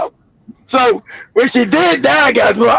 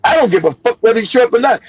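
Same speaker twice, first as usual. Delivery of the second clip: usual speaking voice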